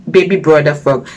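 ordinary delivery, nothing marked

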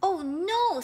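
high, loud cry of dismay; dismayed elephant. Acted. Elephant sees his stretched nose, dismayed.

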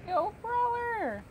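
drawn-out high greeting to a pet; calling happily to the dog; cheerful call. Real, not acted. Streamer greeting his in-game dog, slow and high.

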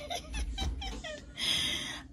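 a high-pitched burst of laughter; real giggle, very high; high squealing laugh. Real, not acted. Laughing at own joke.

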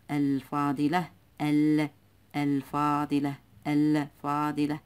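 الفاضلة with a held, audible L in ال; lam pronounced. Shown as a demonstration. Teacher drilling the moon lam, الفاضلة with its L sounded. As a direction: split ال off and stress its L before فاضلة. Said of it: "إذن اللام تنطق".

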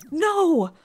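loud high no of dismay; dismayed cry. Real, not acted. Gamer crying out as her crafted item gets scrapped. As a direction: yelp it out as the item vanishes.

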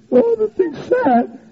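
high, slow whimpering imitation; mock crying. Sister explaining her tears at a movie, mock crying. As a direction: imitate a tearful sister, drawn out. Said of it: "began to start crying".

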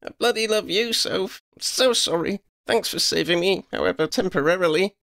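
high-pitched affectionate outburst; high, understated amused gratitude; light laughing delivery. A narrator's reading. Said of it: "Lydia laughed".

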